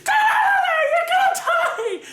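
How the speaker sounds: very high, laughing shriek; mom yelling. Retold or imitated. Mom yelling at the TV hero, high laughing shout. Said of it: "She's yelling at the T V screen".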